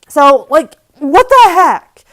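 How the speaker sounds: loud, high, slow what the heck; exasperated outburst. Real, not acted. Venting to viewers about the cashier, loud slow disbelief. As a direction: drag it out in disbelief.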